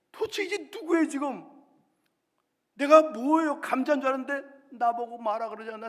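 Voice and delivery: high, loud, desperate questioning; anguished outcry. Acted. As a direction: cry out in identity panic. Confused potato crying out.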